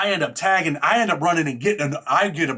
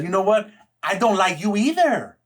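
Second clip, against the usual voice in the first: sarcastic; 6 semitones higher than usual. Retold or imitated. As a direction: drag it out with fake politeness. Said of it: "I'm being sarcastic".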